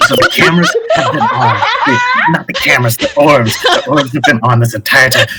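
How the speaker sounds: lightly voiced villain, laughing through the speech; gleeful villain. Kidnapper reveals the show to captives, mildly gleeful villain. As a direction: rant with amused menace.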